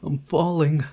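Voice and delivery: panicked pleading. Acted. Nightmare, can't block the noise.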